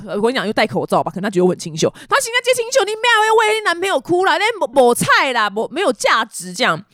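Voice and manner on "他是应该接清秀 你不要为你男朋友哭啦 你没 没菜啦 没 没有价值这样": much higher, animated uncle imitation; scold-comfort like a local Taiwanese uncle, pitched up; neighbour uncle. Neighbour uncle says boyfriend isn't worth tears, high animated imitation. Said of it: "然后他就说".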